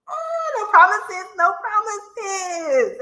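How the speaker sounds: squealing through a laugh; laughing protest. Real, not acted. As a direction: laugh it off, teasing. Playful retort to a viewer's warning.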